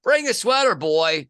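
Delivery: loud, high mock scolding; gruff dad retort. Father answering the cold kid at the range, loud mock scolding. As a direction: tease the kid back, playfully.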